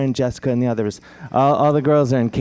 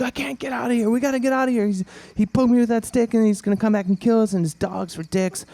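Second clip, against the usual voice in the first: high-pitched frantic captive; hammed-up trapped victim; mock panic; 11 semitones higher, faster than usual. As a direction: panic in a high running stream. Caged captives voiced in a panic.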